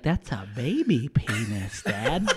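lightly voiced small boy delivering a verdict; imitating little son. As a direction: draw it out like a blunt toddler. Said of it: "He's like".

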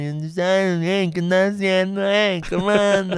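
lazy stoned drawl, slowed; mock stoner greeting, drawn out. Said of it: "Esa es mi voz pacheca".

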